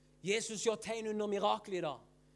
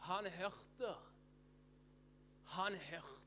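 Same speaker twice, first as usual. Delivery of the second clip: much softer, with long pauses; hushed repetition; 4 semitones lower, 11 dB quieter, much slower than usual. Real, not acted. Preacher letting congregation absorb that he heard, hushed. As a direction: drop right down and let it sink in. Same words repeated hushed after climax.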